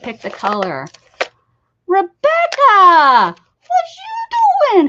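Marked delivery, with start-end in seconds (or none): 1.9-4.9 loud, high, stretched call across the room; drawn-out loud hail to a friend. Real, not acted. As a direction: call her name out drawn long. Calling out to a chat viewer.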